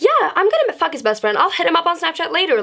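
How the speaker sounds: high, quick mock yell without real volume; retold mad yelling. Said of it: "I'm yelling, like".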